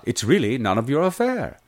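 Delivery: British accent riding on a lilting tune. Shown as a demonstration. Coach fits words to the lilt, British lilt. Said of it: "a couple key lilts".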